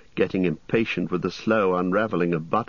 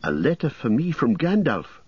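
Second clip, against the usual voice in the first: crying out; 9 semitones higher than usual. Acted. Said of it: "cried Frodo".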